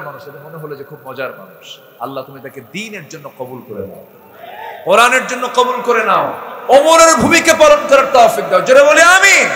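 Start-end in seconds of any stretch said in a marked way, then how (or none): 4.8-9.5 loud, high prayer rising to a shout; preacher leading the congregation in loud prayer; fervent shouted prayer. Acted. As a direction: call the crowd to say amen.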